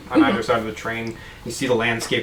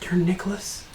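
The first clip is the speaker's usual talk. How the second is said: awed whisper; 7 semitones higher, 9 dB quieter, slower than usual. Acted. Bartender awed at famous guest.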